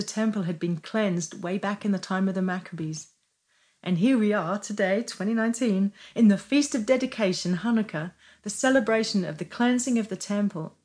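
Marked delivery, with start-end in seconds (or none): none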